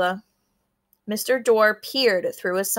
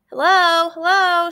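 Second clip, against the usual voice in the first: calling into shell; 8 semitones higher, 7 dB louder than usual. A narrator's reading. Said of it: "holding the shell to her ear".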